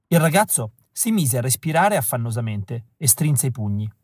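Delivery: ordinary delivery, nothing marked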